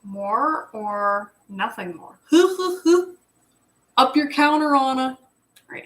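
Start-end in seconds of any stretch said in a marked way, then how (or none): 2.3-3.1 high bright giggle. Real, not acted. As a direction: burst into a giggle. Laughs at chat.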